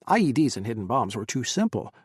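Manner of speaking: ordinary delivery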